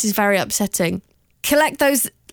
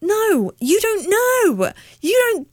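much higher, stretched-out no, you don't know; pushing back hard on the mockers; outraged defence of fangirls; indignant protest; 11 semitones higher, slower than usual. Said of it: "And I feel like".

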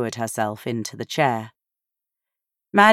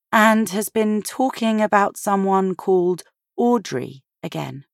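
higher, gently worried woman; a lightly voiced concerned mother; Sophia's voice; 7 semitones higher than usual. A narrator's reading. Sophia tells Maddie.

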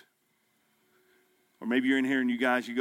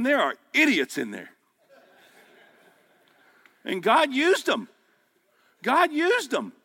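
a pastor shouting to his men that God used idiots; preaching at full force; 9 semitones higher, 5 dB louder than usual. Acted. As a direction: lift the room and drive it home.

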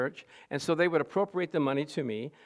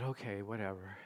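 resigned; 8 semitones lower, 14 dB quieter than usual. Retold or imitated. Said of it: "I said".

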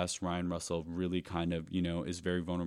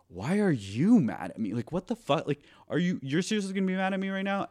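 rising disbelief, higher and faster toward the end; exasperated outburst; 11 semitones higher, 6 dB louder than usual. Retold or imitated. To David after leaving the store, exasperated outburst. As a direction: demand to know why he's mad, voice climbing. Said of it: "And then I'm like".

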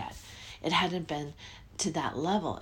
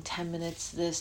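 ordinary talk, nothing marked

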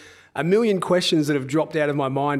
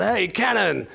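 lightly voiced heckler, pitched higher; mock street heckle, drawn out; yobbo calling out; 5 semitones higher, slower than usual. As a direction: call out like a cheeky yobbo in the street. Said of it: "a yobbo calls out".

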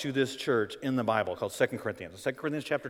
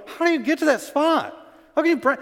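high, loud disbelieving cry; incredulous outcry; 10 dB louder than usual. Retold or imitated. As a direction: shout the question in disbelief as Paul. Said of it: "And Paul's going".

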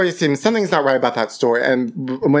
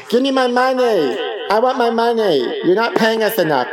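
mock strike scream; 7 semitones higher than usual. Actor at the strike yelling for pay. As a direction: yell at the bosses on strike. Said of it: "I'm gonna scream out".

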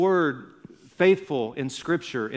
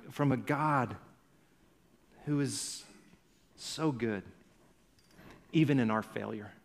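much softer, drawn in close, pleading gently; soft intimate preaching; 3 semitones lower, 6 dB quieter than usual. Acted. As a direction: lean in and almost confide it. Pastor drops to intimate softness.

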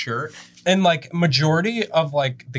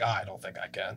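small, hushed, much softer voice; mock timid excuse; 5 semitones lower, 12 dB quieter than usual. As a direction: mumble it as a limp excuse. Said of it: "you just straight up were like".

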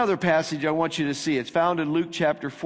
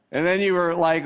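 ordinary talk, nothing marked

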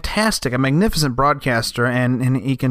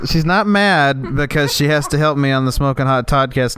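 normal talking voice

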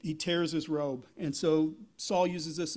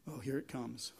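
low, soft inner groan of dread; downward dread; 6 semitones lower, 10 dB quieter than usual. Retold or imitated. Saul's heart dreading Samuel's rebuke, low and soft. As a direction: sink the voice as if the heart speaks. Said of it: "his speak was kind of like in a, a downward tone".

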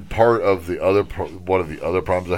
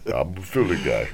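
normal talking voice

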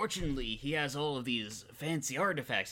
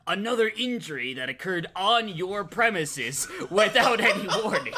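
pointed retort; 6 semitones higher, 6 dB louder than usual. Acted. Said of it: "points at Karama and says".

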